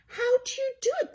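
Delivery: high impressed question. Acted. Brother Bill asking Betty Bunny, high and impressed. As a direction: marvel at her luck. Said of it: "sounding impressed".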